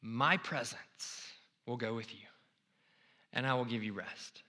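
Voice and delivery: lightly voiced solemn God. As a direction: speak calmly as the Lord. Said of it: "The Lord replied".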